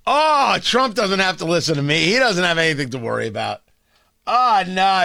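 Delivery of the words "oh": normal talking voice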